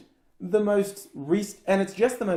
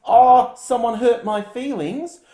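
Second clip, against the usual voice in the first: louder, slow, whiny hurt feelings; mock whine; 5 semitones higher, 8 dB louder, slower than usual. Host mocking Republicans' hurt feelings, whining slowly. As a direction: pout out a wounded whine. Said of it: "all I've seen from them".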